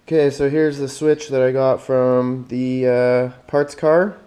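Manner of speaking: ordinary talk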